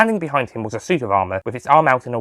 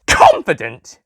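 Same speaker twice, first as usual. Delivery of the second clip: loud, offended exclamation; indignant exclaim; 4 semitones higher, 6 dB louder, much slower than usual. Acted. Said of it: "exclaimed the master".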